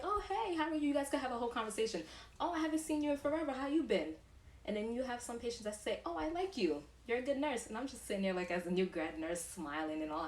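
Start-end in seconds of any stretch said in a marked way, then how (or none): 0.0-4.1 high, bright greeting voice, much higher; cheery patient. Retold or imitated. Patient greeting a familiar nurse, high and cheery. 6.0-7.7 high, sweet praising voice; sweet patient. Retold or imitated. Patient complimenting the nurse, high and sweet.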